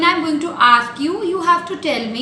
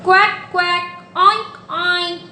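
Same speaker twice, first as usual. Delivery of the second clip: higher quacks and oinks; playful animal imitations; duck and pig sounds; 4 semitones higher, slower than usual. Shown as a demonstration. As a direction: play the duck and pig for toddlers.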